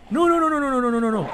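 high, loud panicked pleading; frantic denial, pitch shooting up. Real, not acted. Panic as zombie attacks.

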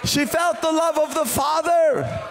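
high, shouted revival preaching with stretched words; rally the congregation at full force; impassioned preaching. Real, not acted. Preacher urging church to be full of Jesus, impassioned shouting.

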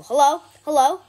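loud, high, questioning hello; calling out. Real, not acted. Player calling out hello mid-game, loud. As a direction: call hello loudly, pitch jumping up.

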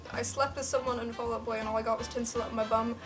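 normal talking voice

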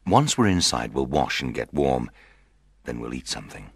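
deep, hushed comforting; affectionate. Acted. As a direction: murmur tender reassurance to a bride. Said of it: "Petruchio said affectionately".

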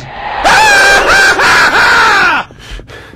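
long, high, very loud shriek; full-out scream of fright; terrified scream. Real, not acted. Player scared by the game, long shrill scream.

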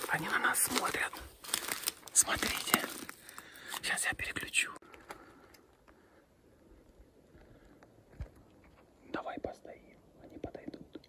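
soft steady whisper; whispering while hiding. Real, not acted. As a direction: whisper so the horses stay put. Whispering near horses.

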